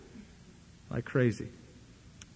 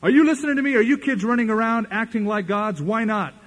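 much higher, louder scolding; mock exasperated parent; 7 semitones higher, 6 dB louder than usual. Parent scolding kids playing gods, much higher and louder.

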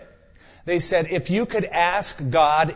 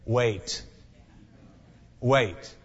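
softer, lower "wait"; soft solemn wait; 5 semitones lower, 5 dB quieter than usual. Retold or imitated. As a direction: say "wait" quietly and gravely. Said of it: "this is the dreaded answer".

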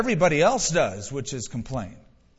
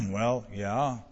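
low, soft, mock-nervous reply; nervous admission; 6 semitones lower, 6 dB quieter than usual. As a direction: admit it sheepishly after clearing the throat. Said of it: "Very nervously, he said".

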